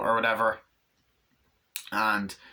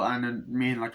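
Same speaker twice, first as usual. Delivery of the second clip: usual speaking voice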